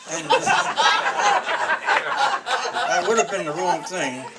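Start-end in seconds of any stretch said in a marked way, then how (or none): 0.4-2.9 loud high laughter; real laugh, high and loud; big laugh. Real, not acted. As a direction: burst out laughing at your own near-blunder. Laughs at almost blurting out.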